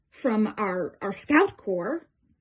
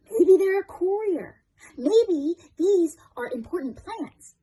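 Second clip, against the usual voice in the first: high, louder burst of realisation; sudden speculation; 7 semitones higher, 3 dB louder than usual. Acted. Soldier spotting what the wounded enemy clutches, high excited guess.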